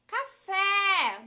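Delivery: café yelled high and loud, fé landing hard; shouted word. Shown as a demonstration. Teacher leading the class in shouting café. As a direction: shout café as if calling across the house. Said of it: "vamos gritar café?".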